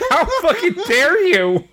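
high-pitched playful indignation; mock outrage. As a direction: feign being scandalized, amid laughter. Mock outrage over a joke.

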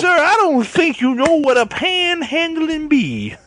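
put-on old prospector voice. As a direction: scold like a crusty old miner. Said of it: "you're an old prospector".

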